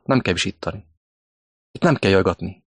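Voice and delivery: ordinary talk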